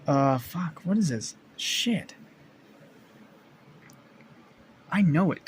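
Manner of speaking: exasperated, rising voice; real frustration. Real, not acted. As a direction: curse yourself for forgetting. Frustrated at forgetting a character's name.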